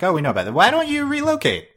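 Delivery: high, mocking put-on villain voice; voicing the villains' smug reply, a high mock voice; mock villain quote. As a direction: toss it off like a smug bad guy. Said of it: "So they were like".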